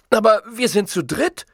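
high, indignant complaint; whiny, high-pitched protest. A narrator's reading. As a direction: object to an unfair share. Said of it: "beschwerte sich Zolfo".

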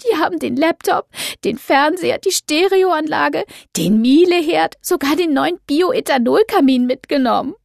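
high, loud, outraged listing; upset young woman. Acted. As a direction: wail the list of losses. Doro listing stolen goods.

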